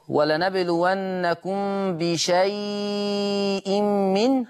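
بشيء with a held medium ya, very slow; medium lengthening. Shown as a demonstration. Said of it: "هذا هو التوسط".

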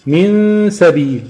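"من سبيل" with a long, high nasal hum before the seen; ghunna, then letter. Shown as a demonstration. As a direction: hold the hum in "من" very slowly, then release into "سبيل". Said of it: "بعد ما بخلص الغنة بجيب الحرف".

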